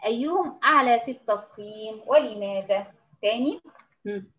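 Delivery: usual speaking voice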